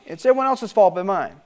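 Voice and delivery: ordinary talk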